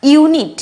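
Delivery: "ইউনিট" opening on a full "you". Shown as a demonstration. Teacher showing U's own name, "ইউনিট" with yoo. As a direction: sound out "ইউনিট" slowly with yoo first. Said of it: "ইউ ভাবেই উচ্চারণ করছি".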